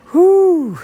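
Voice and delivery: high, loud joyful whoop; excited whoop. Real, not acted. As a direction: let out a big happy whoop. Excited cheer after catching fish.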